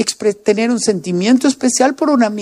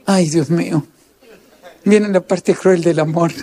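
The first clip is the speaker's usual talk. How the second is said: usual speaking voice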